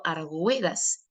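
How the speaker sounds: "argüedas" with U and E as one diphthong; wrongly sounded U. Shown as a demonstration. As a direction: say "argüedas" with a gw glide, ar-GWE-das. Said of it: "usted pronunciaría, por ejemplo".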